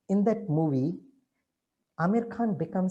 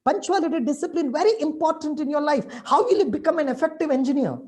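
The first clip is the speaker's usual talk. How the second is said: scolding; 7 semitones higher than usual. Retold or imitated. Teacher scolding late student.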